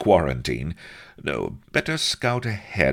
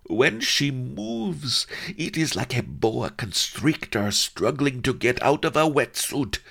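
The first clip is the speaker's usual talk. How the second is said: high, excited courtier voice; breathless chamberlain; 10 semitones higher than usual. Acted. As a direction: gush about her breathlessly. Chamberlain breathlessly describing countess.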